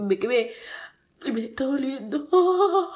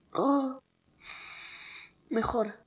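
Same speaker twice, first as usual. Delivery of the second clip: ordinary talk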